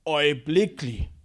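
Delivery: "Øjeblikkelig" slow, syllable by syllable; "Øjeblikkelig" as a dramatic announcement; emphatic. Shown as a demonstration. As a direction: stretch and punch each part of "Øjeblikkelig". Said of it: "Kan vi sige øjeblikkelig?".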